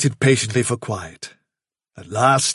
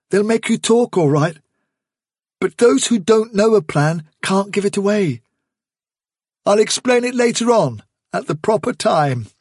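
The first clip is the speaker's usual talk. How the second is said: raised, steady leader voice; young leader addressing the warren; Hazel's calm resolve; 8 semitones higher than usual. Acted.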